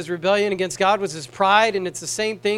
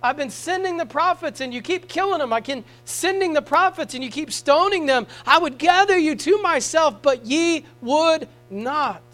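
a hint of an anguished plea, higher and louder at the end; Jesus pleading with Jerusalem; 8 semitones higher, 4 dB louder than usual. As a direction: plead like a heartbroken Saviour, slowing on the last words. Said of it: "he had this passion for Jerusalem".

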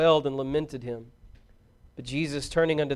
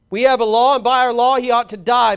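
loud, raised insistence; forceful demand; 6 semitones higher, 10 dB louder than usual. A narrator's reading. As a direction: press the accusation hard.

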